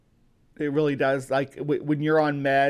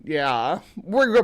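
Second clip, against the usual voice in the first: ordinary delivery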